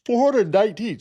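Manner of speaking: said through a yawn. Real, not acted. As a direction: talk mid-yawn, mouth stretched. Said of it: "Sorry, I'm yawning".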